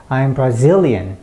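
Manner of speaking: slow "Brazilian" with a big stressed "zil". Shown as a demonstration. Teacher demonstrates to learners, strong middle-syllable stress in "Brazilian". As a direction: lean hard on the middle of "Brazilian", rest light. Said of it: "요 단어만 강세를 넣고".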